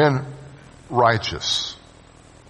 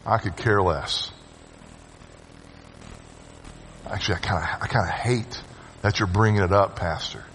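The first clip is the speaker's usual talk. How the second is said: low, softer, bored dismissal; indifferent scoffer; 7 semitones lower, 8 dB quieter than usual. Retold or imitated. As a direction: shrug the pastor off. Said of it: "Scoffer is".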